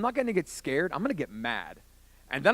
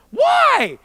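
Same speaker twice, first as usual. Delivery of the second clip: very high, loud whine of protest; protesting yell; 11 dB louder than usual. Retold or imitated. Young man refusing to leave the train, high protesting yell. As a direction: yell it like a thwarted kid.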